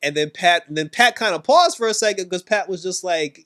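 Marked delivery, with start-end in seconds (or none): none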